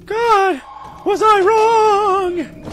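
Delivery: high, slow character voice; high-pitched heroine voice; Emma voice. Acted. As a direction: play Emma, loud and high. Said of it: "I forgot to do my Emma voice".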